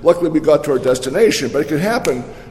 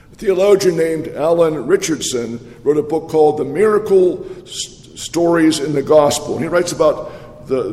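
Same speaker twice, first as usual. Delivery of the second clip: ordinary delivery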